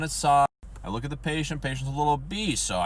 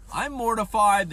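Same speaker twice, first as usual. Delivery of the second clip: usual speaking voice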